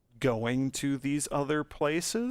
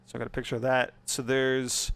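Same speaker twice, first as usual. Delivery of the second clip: ordinary delivery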